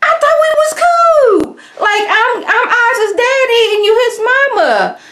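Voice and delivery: high, loud panicked man, laughing through it; mock a man in a frantic meltdown; freaking out. Kai panics at being turned in, high loud frantic voice. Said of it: "Kai's freaking out like".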